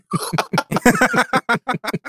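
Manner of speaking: high-pitched cackle; cracking up helplessly; a long high laugh. Real, not acted. Cracking up at accent.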